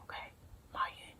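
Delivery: a fast whispered aside. Real, not acted. Whispered aside, still preferring Taobao to Amazon. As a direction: whisper it, as if for nobody.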